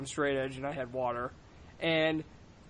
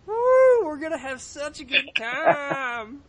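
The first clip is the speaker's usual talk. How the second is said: squealed, much higher woo, then a slow whooping line; mock drunk cheer; 9 semitones higher, 7 dB louder, much slower than usual. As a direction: ham up a tipsy friend's cheer. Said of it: "You already sound drunk".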